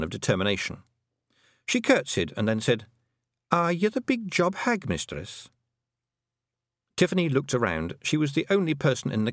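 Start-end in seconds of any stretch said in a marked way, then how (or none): 3.5-5.5 high-voiced little Scots character; Fionn's voice. A narrator's reading. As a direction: speak high as a small formal Scots woman. Fionn addresses Tiffany in dialect.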